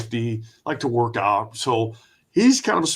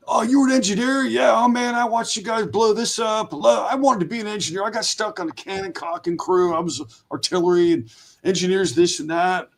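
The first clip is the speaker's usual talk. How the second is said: much higher, eager guard voice; pitched-up chatty veteran guard; excited marine guard; 7 semitones higher than usual. Retold or imitated. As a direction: gush like a thrilled old marine. Guard gushing over fellow veteran.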